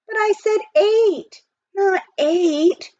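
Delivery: high, loud, slow protest. A narrator's reading. Jack protesting to the auctioneer, high loud dismay. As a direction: plead that it's all a mistake. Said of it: "Jack protested".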